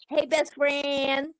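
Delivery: slow, sugary mock friendliness; lightly mocking, drawn-out hello; mock sweet greeting. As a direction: sneer it like a fake friend. Mocking fake friendship with Fran.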